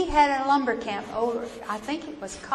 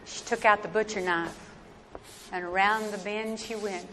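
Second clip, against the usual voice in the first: ordinary delivery, nothing marked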